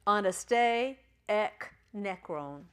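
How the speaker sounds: "Anaste ek necron" slowly, each word set apart; pronunciation model. Shown as a demonstration. Teacher models the Greek phrase, "Anaste ek necron" slowly word by word. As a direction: sound out "Anaste ek necron" at half speed. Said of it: "anybody wanna try to pronounce that".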